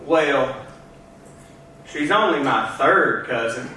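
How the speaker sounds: low, slow Southern drawl with long pauses; deep, unhurried good-old-boy Southern twang; thick Southern accent. Acted. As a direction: put on a thick Georgia accent, pitched low. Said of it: "used to have a quite thick accent".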